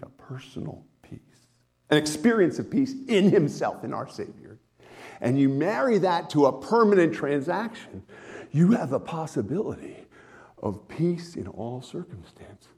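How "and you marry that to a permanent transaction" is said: ordinary delivery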